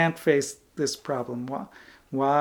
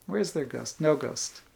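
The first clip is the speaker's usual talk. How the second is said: ordinary talk, nothing marked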